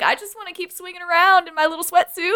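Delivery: put-on high, giggly teen whine; mock Peter voice. Imitating Peter to a podcast friend, high and laughing. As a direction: squeak it out like a scared kid.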